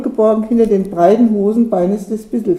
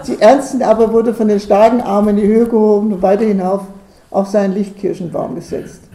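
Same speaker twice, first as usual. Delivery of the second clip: ordinary delivery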